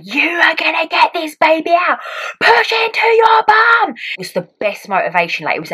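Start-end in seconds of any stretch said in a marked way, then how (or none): 0.0-3.9 high, loud, drawn-out coaching yell; midwives' shout. Retold or imitated. Two midwives urging a labouring mother, shouting. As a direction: shout them through the push. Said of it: "shouting at me".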